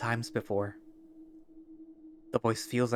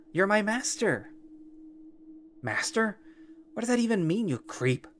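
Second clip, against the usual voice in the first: angry captive; 7 semitones higher than usual. Acted. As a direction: shout at the creep to get out of your head. Captive yells at the creature.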